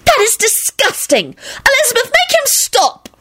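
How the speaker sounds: very high, drawn-out squeal; acted high-pitched appeal for help; disgusted screech. A narrator's reading. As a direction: screech it in revulsion. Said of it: "Rosie screeched".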